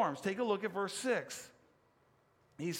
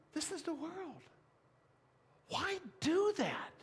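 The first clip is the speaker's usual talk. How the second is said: mock chiding voice; 9 semitones higher, 3 dB quieter than usual. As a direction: raise the pitch in mock disbelief. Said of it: "the voice that says".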